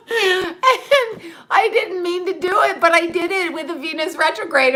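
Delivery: words squeezed out through laughter, high; laughing while talking. Real, not acted. Telling viewers about the botched cut while laughing. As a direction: talk through real giggles. Laughing at her own haircut blunder.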